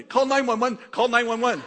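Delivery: high, loud mock panic in broken English; panicked broken-English yell. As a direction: yell it like the frightened husband. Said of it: "in broken English, it's really funny".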